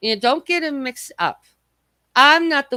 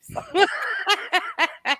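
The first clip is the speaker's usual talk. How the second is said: sudden high cackle of laughter; high burst of laughter; 10 semitones higher, 3 dB quieter than usual. Real, not acted. Laughing high at her friend's joke about Easter.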